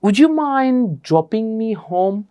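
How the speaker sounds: Would you mind dropping me home, very slow and courteous; slow polite request. Shown as a demonstration. Teacher modelling a polite ride request instead of a blunt one. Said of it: "না বলে আপনি বলতে পারেন".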